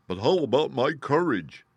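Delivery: a touch of worry in a gruff lion voice; anxious Lion. Acted. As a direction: ask nervously, lightly voiced Lion. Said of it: "asked the Lion anxiously".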